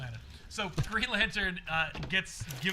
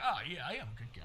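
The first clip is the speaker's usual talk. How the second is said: low, soft, quick self-congratulation; mock modesty; 5 semitones lower, 7 dB quieter, much faster than usual. Green Lantern accepting a man's thanks, mock-modest. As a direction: murmur it, quietly pleased with yourself. Retelling hero basking in thanks.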